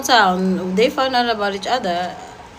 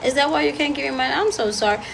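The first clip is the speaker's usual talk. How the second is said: fake pity; 5 semitones higher than usual. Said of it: "and be like".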